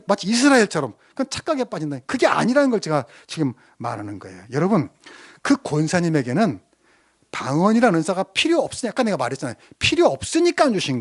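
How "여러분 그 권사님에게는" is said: ordinary talk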